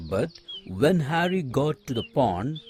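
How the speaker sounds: usual speaking voice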